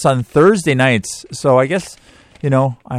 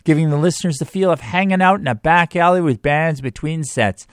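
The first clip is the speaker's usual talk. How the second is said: ad read; 7 semitones higher than usual. Acted. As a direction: read it like a radio ad. Sponsor read on radio.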